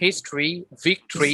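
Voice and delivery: "history", "victory" slowly as his-tree, vik-tree; model pronunciation. Shown as a demonstration. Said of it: "ট্রি, ট্রি". ("history, victory", শেষে ট্রি ধ্বনি)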